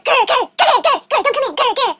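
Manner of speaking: grumpy beast guarding his door; growling beast. Acted. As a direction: growl the words like a comic monster. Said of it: "The beast goes".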